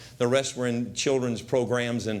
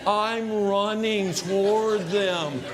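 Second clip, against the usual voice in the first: slow-motion reunion; slow-motion narration; 4 semitones higher, much slower than usual. Acted. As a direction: play it like a film climax.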